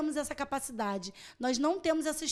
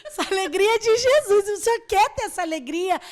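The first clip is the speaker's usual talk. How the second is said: joyful excitement; 6 semitones higher, 9 dB louder, faster than usual. Retold or imitated. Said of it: "Aí eu".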